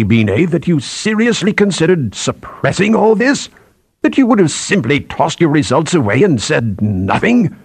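high-pitched, incredulous scolding; indignant boss, pitch leaping up; outraged senior scientist. Acted. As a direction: challenge the subordinate in indignant disbelief.

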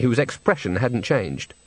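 ordinary delivery, nothing marked

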